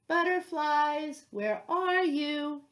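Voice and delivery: loud, slow calling into the dark. A narrator's reading. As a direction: call out, searching for the butterflies. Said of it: "he called".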